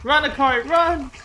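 high frantic shouts to run; yelling while running away. Real, not acted. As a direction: scream at her to get away. Yelling at teammate to flee.

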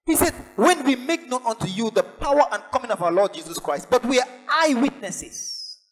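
high-pitched, insistent sermon voice; fervent preacher hammering each phrase; emphatic preaching. Acted. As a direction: declare the scripture with urgent conviction. Preaches the scripture reading emphatically.

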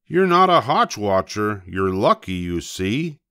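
ordinary delivery

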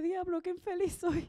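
hammed-up miserable moan; mock tearful lament. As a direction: play at crying in luxury, drawn out. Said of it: "estar llorando bajo la Torre Eiffel".

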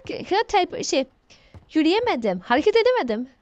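ordinary talk

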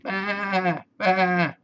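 high, drawn-out baa; sheep bleat. A narrator's reading. Sheep calling to the spider in a story, bleating. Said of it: "bleated the sheep".

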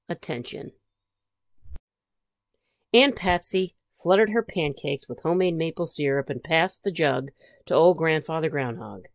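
ordinary delivery

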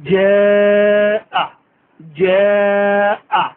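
জাআ with hard j and held vowel; firm jim, long vowel. Shown as a demonstration. Teacher showing hard jim on জাআ. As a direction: stress the j, then stretch. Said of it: "জিম উচ্চারণ একটু শক্ত হবে".

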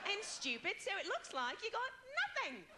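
very high, sing-song Australian agent; Australian uptalk. Acted. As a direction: deliver it like good news in exaggerated Australian upspeak. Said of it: "they go up at the end of a sentence".

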